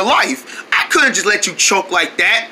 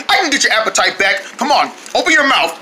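normal talking voice

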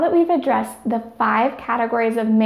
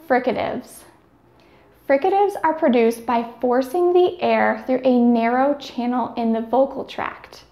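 ordinary talk

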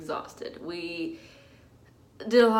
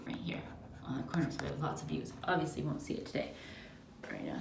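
fast, soft hushed whisper; 6 semitones lower, 13 dB quieter, faster than usual. Real, not acted. Bride showing the hotel room at night, whispering. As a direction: whisper so no one wakes.

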